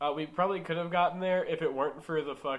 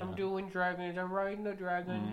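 silly put-on voice; 5 semitones higher than usual. Silly stoned rambling voice.

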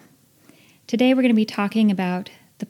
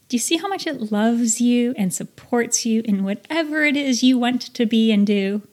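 usual speaking voice

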